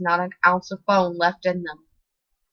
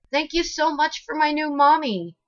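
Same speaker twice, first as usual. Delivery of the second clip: put-on little girl; 9 semitones higher than usual. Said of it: "she said, nuzzling her face into him".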